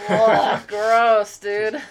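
loud disgusted groan of revulsion; grossed out, loud and high; annoyed ugh. Real, not acted. Disgust at inhaling cigars.

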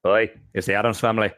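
bad Irish accent, quick; hammy Irish brogue; hideous Irish accent. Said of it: "me doing my hideous Irish accent to pretend to be Heretic".

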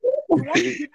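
laughing disbelief. Real, not acted. Heated football argument, laughing disbelief.

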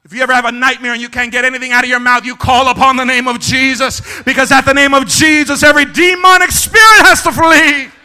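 building to a high, loud pitch; ringing pulpit shout; full-force preaching. Real, not acted. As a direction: preach it harder with every phrase.

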